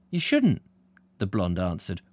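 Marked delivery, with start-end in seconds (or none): 0.1-0.6 perking up, high rise and drop; brightening up. Acted. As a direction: brighten a little, pitched well up. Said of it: "brightening up again".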